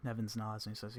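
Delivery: ordinary talk, nothing marked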